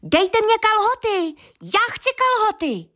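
put-on very high little boy's cry; high tiny voice calling mother; tiny Thumbling shouting. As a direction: squeak it like a thumb-sized boy. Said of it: "křičel".

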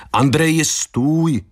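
calling out. A narrator's reading. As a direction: call after someone walking off. Calling after the departing man. (zvolání zvýšeným hlasem)

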